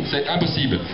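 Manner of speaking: usual speaking voice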